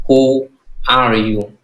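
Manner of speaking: very slow, drawn out who are you; teacher modelling who are you slowly; slow model phrase. Shown as a demonstration. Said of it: "Lo nói trẻ trẻ".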